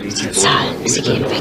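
hushed, urgent whisper. Acted. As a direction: whisper so the others won't hear. Tense talk in a pub. (geflüstert, eindringlich)

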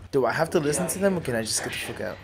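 ordinary talk, nothing marked

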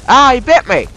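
high, loud startled yelp; sudden shouted outrage and fright; pained alarm. Real, not acted. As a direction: cry out as if just bitten. Bitten by spider in game.

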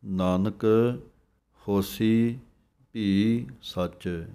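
short pause after Nanak, then slow ਹੋਸੀ ਭੀ ਸੱਚ; brief stop on Nanak then carry on. Shown as a demonstration. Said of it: "Nanak ਪਦ ਦੇ ਉੱਤੇ ਜਮਕ ਹੈ". (ਨਾਨਕ ਤੇ ਜਮਕ, ਥੋੜ੍ਹਾ ਰੁਕ ਕੇ)

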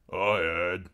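lightly voiced big soldier; a hint of a deep-voiced soldier. A narrator's reading. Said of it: "the big soldier with the deep voice".

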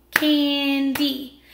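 "Candy" split slowly, CAN-DY; clapped into syllables. Shown as a demonstration. Teacher clapping out the word with pupils, clapped syllables. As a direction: break "Candy" into two distinct beats. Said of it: "Let's clap it".

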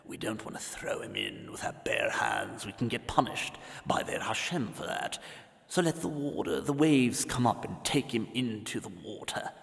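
hushed, scheming whisper; conspiratorial whisper. Acted. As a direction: whisper the evil plan so no one hears. Pharaoh plotting in secret.

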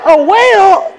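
hearing about the whale, high shocked. Retold or imitated. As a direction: yelp it in shock. Shocked at exaggeration.

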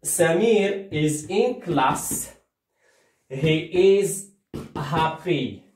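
سمير is in class spoken very slowly for beginners; slow model sentence. Shown as a demonstration. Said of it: "شوف معايا مزيان".

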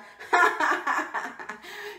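big mocking belly laugh, acted; lion's laugh. A narrator's reading. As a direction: laugh like a scornful lion. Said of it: "starts to laugh".

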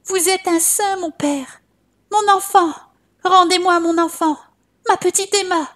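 high, pleading cry; grieving mother cries out to the monk, pitched high. A narrator's reading. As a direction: beg the priest for the child back. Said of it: "et lui cria".

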